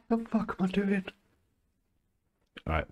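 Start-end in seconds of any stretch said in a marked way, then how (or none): 0.1-1.0 high voice squeezed by laughter; real, helpless laughing speech. Real, not acted. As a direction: say it through a giggling fit. Laughing at himself while recording.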